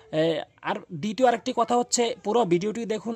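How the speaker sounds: usual speaking voice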